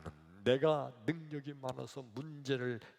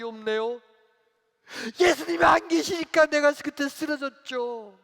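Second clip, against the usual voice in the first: loud, high protest; acted accusation; 10 semitones higher, 15 dB louder than usual. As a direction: challenge Jesus for being absent. Acted deacon accusing absent Jesus. (크고 높게 따지듯)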